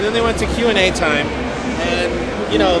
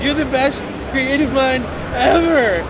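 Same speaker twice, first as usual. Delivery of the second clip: higher, slow, lightly voiced earnest fan; gushing fan; 8 semitones higher, slower than usual. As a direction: drag it out like a starstruck fan. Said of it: "he says".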